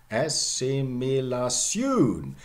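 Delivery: ASSIMILATION as "assimila-SYOON", very slow; older pronunciation. Shown as a demonstration. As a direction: draw out assimilation, stressing the final "syoon". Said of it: "this is true for all".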